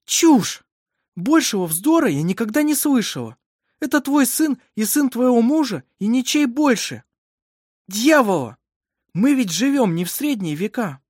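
loud, high, forceful rebuke; indignant scolding. A narrator's reading. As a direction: dismiss superstition in outrage. Midwife angrily rejects the superstition.

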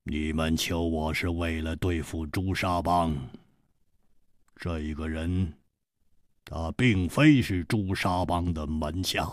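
low, soft, measured reply; hushed, level, deliberate voice; unhurried. A narrator's reading. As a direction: answer calmly, low and quiet. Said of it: "缓缓地说".

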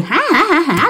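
mock eerie "ha ha" laugh; imitated ghost laugh. Ghost woman starts laughing at dad, imitated laugh. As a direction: spell out a ghostly cackle. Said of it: "Bắt đầu cười".

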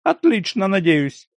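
high mimicking sneer; mocking mimicry. A narrator's reading. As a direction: parrot his words back to mock. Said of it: "передразнил Ляховский".

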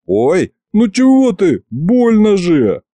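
high, drawn-out protest of pain; slow, high-pitched mock yelp. A narrator's reading. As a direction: whine that it hurts, stretching the words. Grandson yelps, hurt by grandfather.

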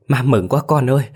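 mother half crying with relief, voice rising; joyful crying. A narrator's reading. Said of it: "bà mừng phát khóc lên". (mừng phát khóc, giọng cao lên)

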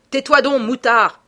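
explosive angry command; furious yell. A narrator's reading. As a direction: yell to silence an insolent youngster. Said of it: "hurla Lupin".

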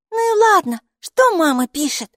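loud, very high-pitched question; high character voice. Acted. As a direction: ask eagerly in a squeaky high voice. Майки voice asks from behind creature.

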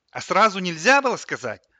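loud, high exasperated question; annoyed retort. A narrator's reading. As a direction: throw it back, fed up. Boy snaps back at fridge. (раздражённо и громко)

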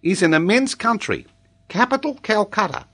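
put-on high singsong child recital, lifting at each item; reciting schoolboy. A narrator's reading. Boy recites possessions to his examiner, high schoolboy voice. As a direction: rattle off a learnt lesson.

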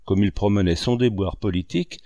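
ordinary delivery

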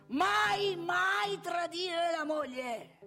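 high, loud, stretched proclamation; comandamento solenne. Acted. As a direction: thunder it out slowly as a rule. (monito gridato, acuto e lento)

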